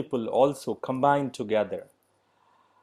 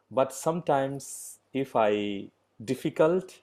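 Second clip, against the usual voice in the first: ordinary talk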